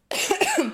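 sudden throat-clearing cough; short dry cough, frog in throat. Real, not acted. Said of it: "j'ai un chat dans la gorge".

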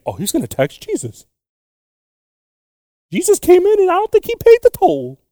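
high, put-on voice of a scandalised onlooker; gossiping bystander. Onlooker in the crowd whispering about the tax man, high mock gossip. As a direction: play a nosy crowd member gasping at the news. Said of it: "There's probably people over there saying".

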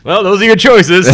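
loud, high mock fatherly verdict; mock dad voice. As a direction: deliver it like a dad.

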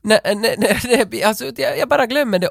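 high, laughing no no; laughing denial. Real, not acted. Denying wetting himself to his friend, laughing. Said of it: "laughing denial".

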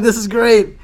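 loud, high burst of retold delight; excited reaction. Prize winner reacting to the good news, retold with loud excitement. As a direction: re-enact the winner's delight at full voice. Retelling his reaction to winning.

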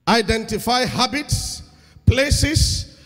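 loud, slow emphatic preaching. Real, not acted. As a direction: drive the point home. Preaching key point.

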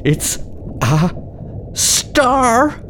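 rising thrilled cry; excited discovery. Acted. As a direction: squeal star up high. Children discover the star.

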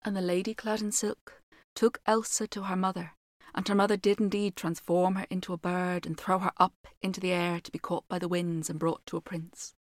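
measured fairy tale telling; story narration. A narrator's reading. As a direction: read it like a storyteller by the fire. Reads fairy tale aloud.

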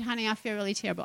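very soft, quick mock moan; quiet little put-on sickly aside; feeble complaint. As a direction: play poorly, barely putting any voice into it. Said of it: "I could have laid there, said".

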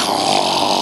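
long breathy "oh"; imitated shocked gasp. Dad stung by son's holy comeback, shocked gasp. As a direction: gasp it out, stunned.

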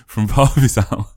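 laughing through "From Parvizal"; chuckling, slow. Real, not acted.

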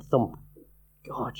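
ordinary delivery

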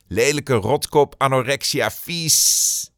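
a hateful troll's message voiced with contempt; sneering insult. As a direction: jeer each word, hiss the last.

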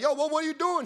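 high-pitched, startled protest; retold startled challenge; alarmed questioning. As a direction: act out bewildered alarm. Confronting driver who reverses away.

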